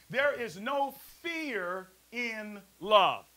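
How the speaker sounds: very slow preacher's emphasis; slow drawn-out proclamation. Acted. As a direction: stretch every word slowly. Preacher drawing out scripture.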